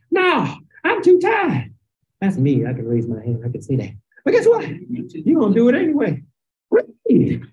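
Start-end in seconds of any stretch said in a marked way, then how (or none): 0.1-1.7 slow, high, drawn-out mock whine; hammed-up tired excuse; whiny mock complaint. Mocking a lazy believer.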